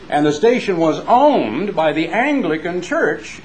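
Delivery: ordinary talk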